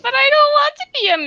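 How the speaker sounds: whiny protest. Real, not acted. Protesting becoming a meme.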